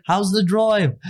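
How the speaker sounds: high, put-on English accent. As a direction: do the English car guy checking in. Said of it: "the guy's got an English accent".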